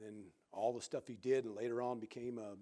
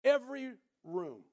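Every punched higher and louder; emphatic; 11 semitones higher, 10 dB louder than usual. Real, not acted. Preacher stressing Jesse's every room, Every higher and louder. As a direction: hit Every hard.

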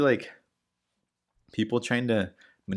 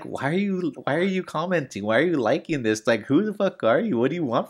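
exasperated questioning; 4 semitones higher, 6 dB louder than usual. Acted. Acts out baffled challenge to spammer.

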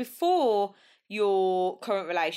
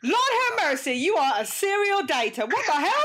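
much higher, incredulous outburst; amused shock; 8 semitones higher, 4 dB louder than usual. Real, not acted. Host hearing guest is a serial dater, shocked. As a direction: exclaim in scandalised disbelief, rising at the end.

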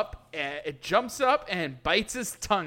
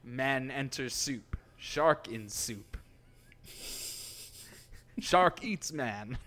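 low, slow announcer read; slow dramatic title read; 5 semitones lower, slower than usual. Pretend. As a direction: spell out each title slowly, deadpan. Reads mock episode titles.

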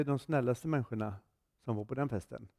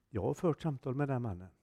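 ordinary talk, nothing marked

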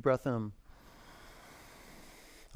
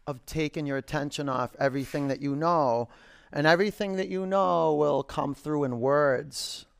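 guided meditation; slower than usual. Acted. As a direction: ease them into stillness.

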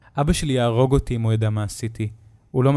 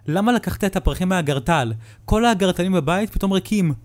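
much higher, louder demand; accusing mother; 8 semitones higher, 4 dB louder than usual. Acted. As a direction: confront him. (אמא מאשימה בכעס)

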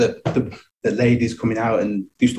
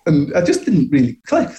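ordinary delivery

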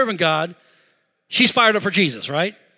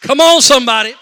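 loud, raised preacher's shout; big hollered appeal; calling for response; 4 semitones higher, 12 dB louder than usual. Real, not acted. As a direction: rouse the congregation. Preacher calls congregation to respond.

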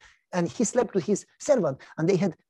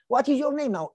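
much higher mocking neighbor voice; teasing neighbor; 8 semitones higher than usual. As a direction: needle him like a nosy neighbor. Neighbor Jim.

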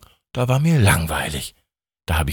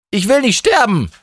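high-pitched, acted desperation; panicked plea; 11 semitones higher than usual. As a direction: plead for your life, pitched right up. Steffi pleads as avalanche approaches. (flehend, mit hoher, gespielt panischer Stimme)

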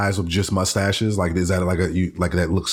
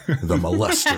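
villain voice. Acted. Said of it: "Sound like a villain".

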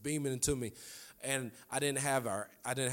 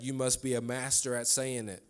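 ordinary talk

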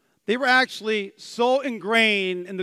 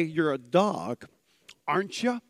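affectionate teasing; 3 semitones lower, 3 dB quieter than usual. Shown as a demonstration. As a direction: say it fondly, like to a pet. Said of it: "or you can say".

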